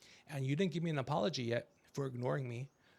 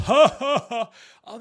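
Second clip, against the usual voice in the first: laughing; 8 semitones higher, 15 dB louder than usual. Real, not acted. Laughs at son's mock laugh.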